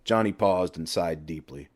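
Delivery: ordinary delivery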